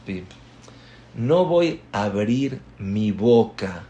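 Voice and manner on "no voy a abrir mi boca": normal talking voice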